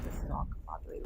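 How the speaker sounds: breathy whisper, dropping way down for a moment; half to herself, whisper a quick self-deprecating aside. Real, not acted. Founder admitting her story is jumbled, whispered aside.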